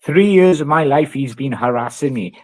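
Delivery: ordinary delivery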